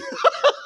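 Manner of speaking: quick high laugh; short laugh. Real, not acted. Poet laughing with the audience at his line, quick high laugh. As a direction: laugh out briefly.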